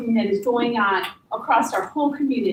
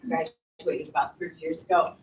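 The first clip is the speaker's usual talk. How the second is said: ordinary talk, nothing marked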